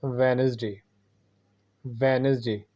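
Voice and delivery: वेडनेसडे with the d silent, wens-day; वेडनेसडे dropping the first d; correct pronunciation. Shown as a demonstration. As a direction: say वेडनेसडे as two syllables, wenz-day. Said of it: "सही इसकी pronunciation है".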